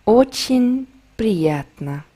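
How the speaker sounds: said slowly and clearly for learners; slow drilled Russian; slow model phrase. Shown as a demonstration. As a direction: spell it out slowly, teacher style. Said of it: "Encantado, encantada".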